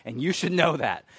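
loud, irritated retort played for laughs; mock grumpy outburst. Grumpy attendee to overly happy college kids, mock outburst. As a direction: scold them in comic exasperation. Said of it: "that's how I felt".

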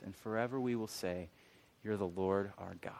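soft, slowing reverent delivery; hushed recital. Retold or imitated. Speaker recalling the song that reached him, hushed recital. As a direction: recite the lyric softly, with long pauses.

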